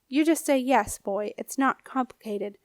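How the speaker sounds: ordinary delivery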